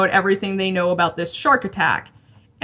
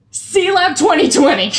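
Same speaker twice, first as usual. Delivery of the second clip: louder, higher thrilled announcement; punchy, raised-pitch exclamation; geeking out; 7 semitones higher, 5 dB louder than usual. Real, not acted. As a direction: proclaim it with fan excitement. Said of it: "I just thought that was so cool".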